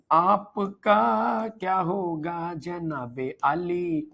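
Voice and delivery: very slow, dramatic filmy delivery; filmi mock line. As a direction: ham it up like a film villain, slowly. Quoting a filmi line mockingly. (फ़िल्मी अंदाज़ में बहुत धीमे, नाटकीय)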